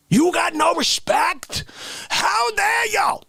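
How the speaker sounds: high mock outraged shout. How Dare You Guy shouting at cohost in mock outrage. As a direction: blow up at him, hammed-up.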